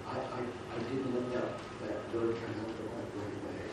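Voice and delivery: quiet, stammering plea for forgiveness; hushed, sheepish stammer; soft mock apology. Preacher voicing apology to wife.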